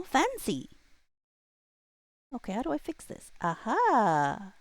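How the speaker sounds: ordinary talk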